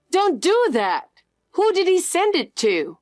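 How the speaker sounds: sudden panic, voice jumps up; alarmed plea. Acted. Mother-in-law panics over leaked texts.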